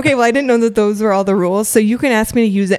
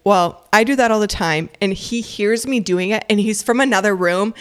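ordinary talk

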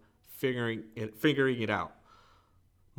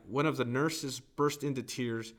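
ordinary delivery

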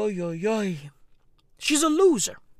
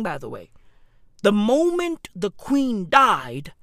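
normal talking voice

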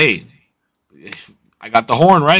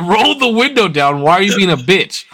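retold insistent demand; 6 semitones higher than usual. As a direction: re-enact pressing a driver to obey. Retells repeating demand to driver.

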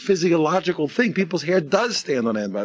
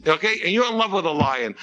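ordinary talk

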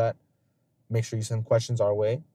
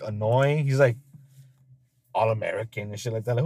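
ordinary delivery

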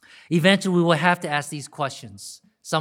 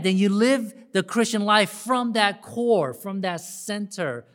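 raised, forceful sermon delivery; emphatic preaching; 5 semitones higher, 6 dB louder than usual. Real, not acted. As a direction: drive the point home to the congregation.

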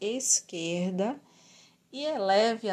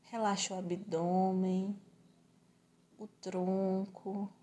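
guided relaxation; slower than usual. Acted. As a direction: slowly talk the body into rest.

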